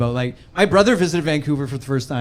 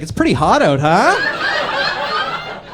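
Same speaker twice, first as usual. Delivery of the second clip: creepy beach guy; 7 semitones higher, slower than usual. Acted. Creepy nude guy voiced.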